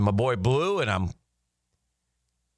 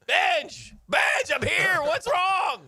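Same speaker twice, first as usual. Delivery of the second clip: alarmed shout; 14 semitones higher, much slower than usual. Retold or imitated. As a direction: yell down the phone to a hurt friend.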